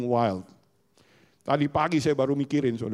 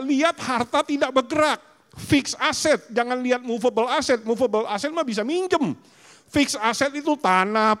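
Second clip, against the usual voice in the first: raised, higher, emphatic preaching; 9 semitones higher, 4 dB louder than usual. Acted. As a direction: drive the point home loudly. Preaching at full force.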